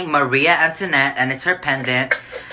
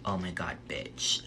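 usual speaking voice

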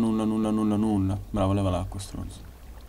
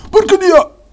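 shout; 9 dB louder, slower than usual. Real, not acted. Frustrated gameplay outburst.

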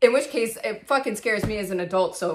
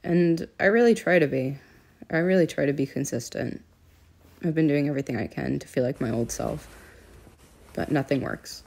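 dramatic confessional monologue; 5 semitones lower, 6 dB louder than usual. Acted. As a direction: deliver it like a moody film narration. Mock-diary monologue continues.

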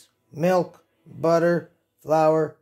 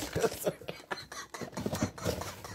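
breathy whispered giggle; 12 dB quieter than usual. Real, not acted. Whispered laugh.

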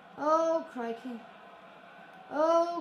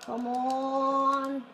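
normal talking voice

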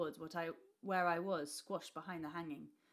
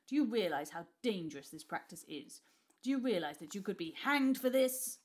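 angry; 7 dB louder than usual. A narrator's reading. Uncle threatens father, rising.

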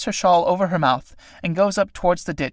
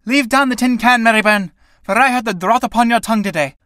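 angry demand; 7 semitones higher, 5 dB louder than usual. Acted. Sarah still angry at Mary.

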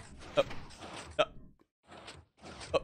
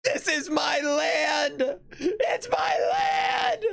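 very high, slow wailing cry; hammed-up wail; 5 semitones higher, much slower than usual. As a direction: wail it like a betrayed ruler. Mock-defiant claim over land.

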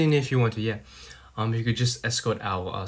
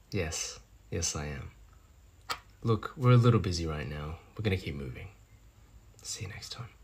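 low, soft, restrained; cold dismissal; 6 semitones lower, 5 dB quieter than usual. Acted. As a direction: quietly brush off a rival, fading to near-whisper. Coldly dismisses partner's ex.

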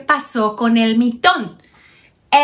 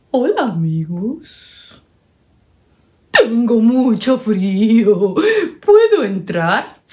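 slow wise owl. Acted. La lechuza sabia habla.

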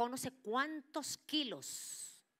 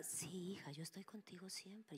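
ghostly whisper; 9 dB quieter than usual. Retold or imitated. Spirit reassures again.

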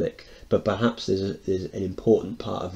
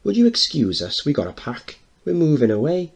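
higher, louder, put-on posh lady voice; 7 semitones higher, 4 dB louder than usual. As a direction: put on airs for the neighbours. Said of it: "To the neighbours in a posh voice".

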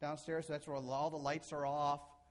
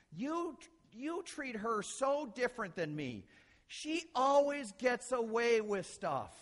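high, drawn-out mock kid's protest; complaining kid; 8 semitones higher, 6 dB louder, slower than usual. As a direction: protest slowly and loudly like an aggrieved child. Voices a child complaining to parents.

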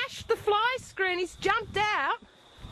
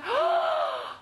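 shocked gasp; 11 semitones higher than usual. Real, not acted. Sees dog's damage.

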